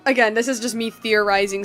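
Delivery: ordinary delivery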